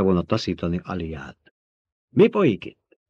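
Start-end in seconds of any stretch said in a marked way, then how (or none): 2.1-3.0 sudden loud, high question; snapped demand. A narrator's reading. Emperor interrupting the two of them, snapping. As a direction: snap it out, demanding an answer. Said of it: "Csattant fel a császár".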